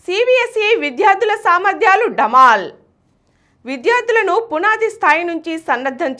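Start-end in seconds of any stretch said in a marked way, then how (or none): 0.1-2.7 headline read. Acted. Story title read as headline.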